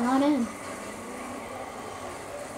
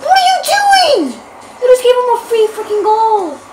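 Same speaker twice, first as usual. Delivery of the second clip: high, very loud outraged yelling; real rage, pitch shooting up; furious screaming; 9 semitones higher, 9 dB louder than usual. Real, not acted. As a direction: scream at a useless teammate. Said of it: "I'm so mad right now".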